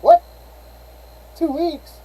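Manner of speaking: high, loud disbelieving "What? Two weeks?"; a manager hearing the real age, high-pitched mock shock; shocked disbelief. Manager hearing the boy's age, high mock disbelief.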